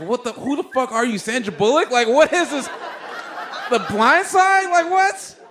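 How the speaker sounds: high, loud mocking disbelief, building; sarcastic outrage. Comic mocking the lady as Sandra Bullock, high sarcastic outrage. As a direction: roast her with rising incredulity.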